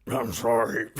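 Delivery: lightly voiced raspy mumbling smoker; a hint of a chain-smoker's rasp; gravelly smoker voice. Vlogger mimics Daryl's mumbled apology, lightly raspy. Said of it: "since it sounds like he smokes twelve packs a day".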